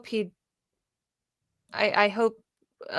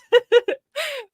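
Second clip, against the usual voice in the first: giggle; 4 dB louder than usual. Real, not acted. Giggling mid-question.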